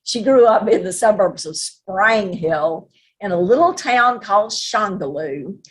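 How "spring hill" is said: "Sprang Hill", Spring stretched toward sprang; "Sprang Hill" with a Southern vowel for Spring; Louisiana pronunciation. Shown as a demonstration. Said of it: "you say Sprang Hill".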